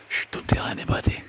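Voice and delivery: hushed, playful "keep it secret" whisper; secretive whisper. Pretend. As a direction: shush them, then whisper conspiratorially. Mock secret shared with viewers.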